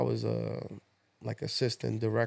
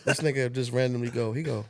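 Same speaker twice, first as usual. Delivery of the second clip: ordinary delivery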